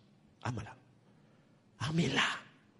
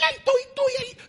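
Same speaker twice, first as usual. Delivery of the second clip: shrill mock scolding, much higher; mock nagging wife; 6 dB louder than usual. Preacher mimicking a wife scolding her husband, high mock nagging.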